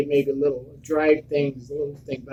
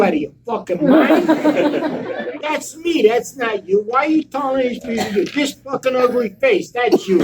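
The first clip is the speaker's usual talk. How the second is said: loud, higher, laughing furious rant; furious tirade; 7 semitones higher, 4 dB louder than usual. Retold or imitated. Confronting the fake stuntman at his table, loud laughing fury. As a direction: tear into the impostor, half laughing.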